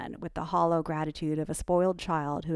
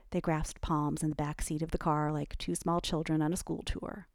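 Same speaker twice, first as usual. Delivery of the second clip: ordinary delivery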